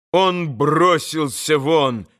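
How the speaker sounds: ordinary delivery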